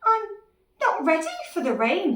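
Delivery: high, loud acted panic; panicking little dinosaur. Dinosaur not ready for the rain, panicky. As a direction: wail it in a worried pitch.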